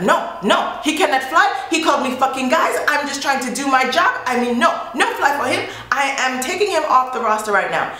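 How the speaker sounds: a light parody of the stubborn agent; mock gate agent. Gate agent refusing Roberto.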